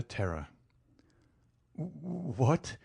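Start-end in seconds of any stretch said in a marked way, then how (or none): 1.7-2.8 broken-off, breathless stammer of shock. Acted. Terrified banker stammers at the count's demand, mild stammer. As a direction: catch on the first sound, then blurt it out. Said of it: "he stammered".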